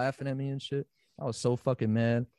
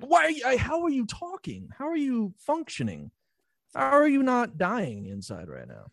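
high, disbelieving questions; incredulous; 10 semitones higher than usual. Retold or imitated. Asking horror fans how they cope, high incredulous voice. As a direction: ask it in pure disbelief.

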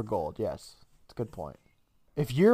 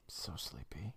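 sleepy whisper; 10 semitones lower, 24 dB quieter than usual. Real, not acted. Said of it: "I've been up all night".

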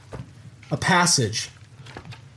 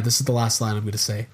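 normal talking voice

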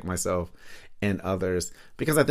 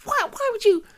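much higher, squeaky outraged disbelief; mock betrayed disbelief. Son to his mom after she sides with his rival, mock outraged disbelief. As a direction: squeal it like your own mom betrayed you. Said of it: "I'm like".

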